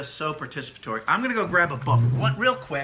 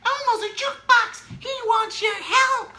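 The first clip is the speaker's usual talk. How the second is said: silly Elmo voice; 6 dB louder than usual. Shown as a demonstration. Parent reading to William as Elmo, very high silly voice. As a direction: play Elmo for a toddler, way up high. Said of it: "and say".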